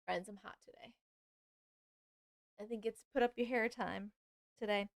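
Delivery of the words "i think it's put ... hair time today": normal talking voice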